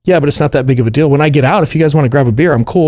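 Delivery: quick, high, breezy shrug; unbothered Fedor. Retold or imitated. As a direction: wave it off cheerfully. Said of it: "he's like".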